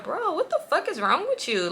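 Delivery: high-pitched exasperated retort; irritated disbelief. Retold or imitated. Stuck in the backseat, confronting her friend, irritated. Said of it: "I'm just irritated".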